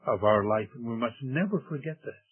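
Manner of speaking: ordinary delivery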